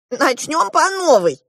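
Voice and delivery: high, drawn-out dwarf voice; eager dwarf. A narrator's reading. The dwarf prepares a test for the girl, eager. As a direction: announce it high and loud.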